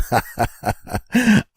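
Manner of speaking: a burst of high, gleeful laughter; high delighted laugh. Real, not acted. Delighted watching wife struggle.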